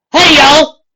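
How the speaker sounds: high, loud call to the group. A narrator's reading. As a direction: get everyone's attention. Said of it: "Applejack said".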